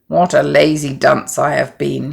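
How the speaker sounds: usual speaking voice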